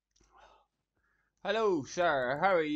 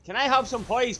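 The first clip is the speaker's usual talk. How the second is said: higher and louder, exasperated; exasperated complaint; 8 semitones higher, 7 dB louder than usual. Real, not acted. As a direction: vent at the game. Frustrated outburst at game.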